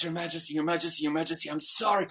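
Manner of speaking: soft, repeated pleading; hushed, mock-fearful grovelling; flustered apology. Beggar man apologizes to the king, flustered and soft.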